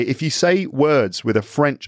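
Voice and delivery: usual speaking voice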